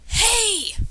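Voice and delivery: high, loud call of "Hey!" to get attention; mother calling her kids back to the car, loud and high. Acted. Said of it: "Mother called".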